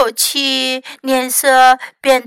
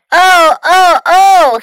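loud, raised theatrical moans; groaning; 6 semitones higher, 8 dB louder, slower than usual. A narrator's reading. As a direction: moan in dismay, each one swelling. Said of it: "he groaned".

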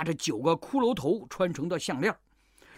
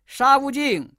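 calling out; 3 semitones higher, 4 dB louder than usual. Acted. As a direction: shout across the river. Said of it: "慧岸高叫".

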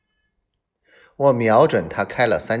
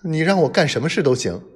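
fast, higher, desperate whimper; 6 semitones higher, faster than usual. Acted. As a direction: plead quickly, on the edge of tears. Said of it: "他呜咽着说".

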